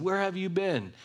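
"Where have you been?" jumping high in pitch; a pained, rising cry of reproach; pleading question. A narrator's reading. Mourners asking Jesus why he came late, pained high cry.

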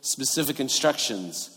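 ordinary delivery

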